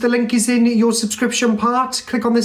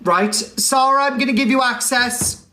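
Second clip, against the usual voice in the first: normal talking voice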